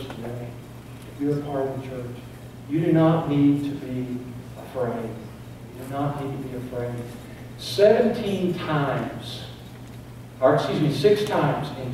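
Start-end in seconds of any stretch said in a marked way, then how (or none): 5.1-6.9 dropped much softer, a gentle reassurance; softer repeat. Real, not acted. Reassuring the church softly not to be afraid. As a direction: pull back and say it softly, like a promise.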